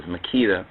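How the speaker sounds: Makeda said as muh-KEE-duh; long EE in the stressed middle of Makeda; alternative pronunciation. Shown as a demonstration. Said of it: "most of those speakers who pronounce as".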